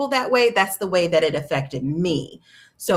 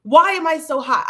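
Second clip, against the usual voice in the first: much higher, louder frantic complaint; desperate exclamation; 13 semitones higher, 8 dB louder, slower than usual. Retold or imitated. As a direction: cry out, overheated and exasperated. Retelling her overheated panic.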